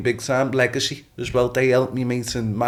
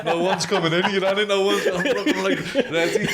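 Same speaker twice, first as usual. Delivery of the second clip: laughing; 8 semitones higher than usual. Retold or imitated. Laughing while acting his car park readiness.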